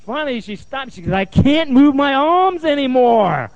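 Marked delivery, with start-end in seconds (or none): 1.1-3.5 slow, high, loud complaint; imitated exhausted wail. Client spent from hitting, loud drawn-out wail. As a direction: wail it out, dragging each word.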